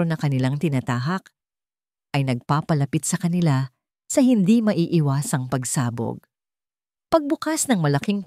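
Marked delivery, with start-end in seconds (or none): none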